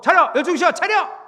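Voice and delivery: loud, high parade-ground shout; sharp drill-sergeant yell; military drill commands. Acted. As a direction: order the troops to attention. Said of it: "이거 왜 군대 같죠".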